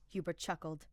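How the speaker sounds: plain reading voice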